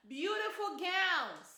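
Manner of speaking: slow, laughing through beautiful gowns; amused slow laugh on the praise. Real, not acted. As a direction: chuckle it out slowly. Laughs while repeating.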